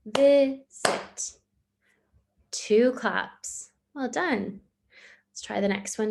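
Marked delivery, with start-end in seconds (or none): 0.1-1.3 "Visit" split into two loud clapped beats; clapped syllables, louder. Shown as a demonstration. Teacher repeats "visit" louder in two clapped beats. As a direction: clap "Visit" out louder, vi-sit. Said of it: "I'll do it one more time".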